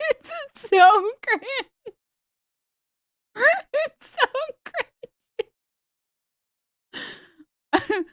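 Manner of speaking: very high, slow, laughing "so cringe". Real, not acted. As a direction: squeal it through laughter.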